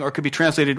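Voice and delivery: ordinary talk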